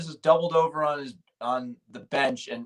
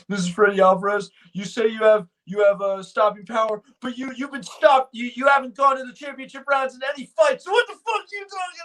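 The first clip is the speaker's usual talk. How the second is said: hammed-up reporter losing it at the presser; mock angry heckler; 11 semitones higher than usual. Boston reporter heckling McGregor, building to an angry shout. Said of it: "He's like".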